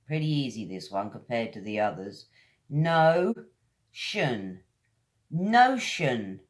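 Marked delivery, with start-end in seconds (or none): 2.7-4.6 No-tion in two beats, shun at end; split word. Shown as a demonstration. Teacher breaking notion into syllables for learners. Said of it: "It's like a shun sound at the end".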